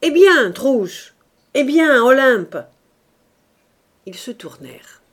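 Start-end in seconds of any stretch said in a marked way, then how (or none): 0.0-2.6 loud, high, thundering summons. A narrator's reading. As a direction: roar at them to come up. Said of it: "cria d'une voix tonnante".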